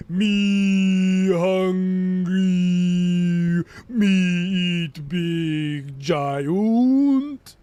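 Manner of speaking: hugely stretched vowels, loud droning call; Mimir. Shown as a demonstration. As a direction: drag every vowel out, swooping up at the end. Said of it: "but with extended vowels".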